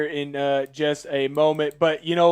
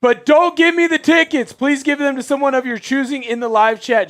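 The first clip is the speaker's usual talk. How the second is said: excited; 8 semitones higher, 4 dB louder than usual. Real, not acted. Excited donor thanks.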